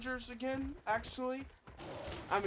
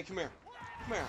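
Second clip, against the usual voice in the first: ordinary talk, nothing marked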